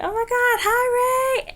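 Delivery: high, loud fangirl squeal; excited fan greeting. Retold or imitated. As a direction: gush it out, shooting way up in pitch.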